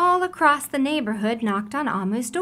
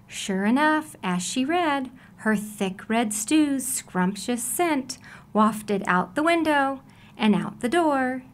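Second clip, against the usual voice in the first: usual speaking voice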